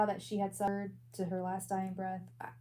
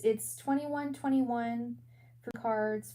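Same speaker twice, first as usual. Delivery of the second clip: usual speaking voice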